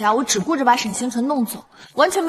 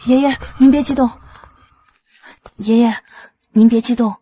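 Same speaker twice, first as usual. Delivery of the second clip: louder, insistent calming; urgent soothing; 2 semitones higher, 6 dB louder than usual. Acted. Granddaughter calming her enraged grandfather, urgent soothing. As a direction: cut in to settle someone down.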